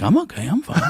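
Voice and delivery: high-pitched, comic self-reassurance; hammed-up nervous insistence; mock panicked reassurance. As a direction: squeak it out as if convincing yourself. Retelling panicked self-check in mirror.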